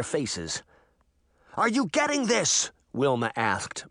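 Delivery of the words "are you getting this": high-pitched, alarmed question; panicking. A narrator's reading. Reporter to her cameraman as giants climb down, panicking. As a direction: ask it in rising panic.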